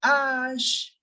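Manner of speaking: "Ash" drawn out with long ah, ending sh; long open "Ash" for the letter H; French letter H. Shown as a demonstration. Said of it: "H will be pronounced as".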